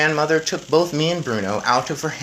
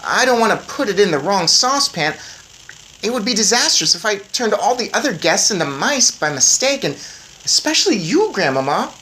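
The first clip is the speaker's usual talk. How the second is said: put-on lightly higher boy voice; boy mouse; 9 semitones higher, 7 dB louder than usual. A narrator's reading. Boy reminding grandmother, light eager boy voice. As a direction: point it out eagerly.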